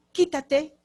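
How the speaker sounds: "Quítate" snapped out high and loud; angry "quítate". Shown as a demonstration. Teacher showing how "Quítate" said harshly starts a fight. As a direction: bark "Quítate" like a shove.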